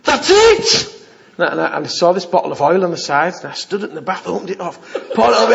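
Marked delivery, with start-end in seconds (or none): 0.0-0.9 high, loud eureka cry; excited exclamation. Retold or imitated. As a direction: burst out with sudden resolve, retold. Retells sudden idea in bath.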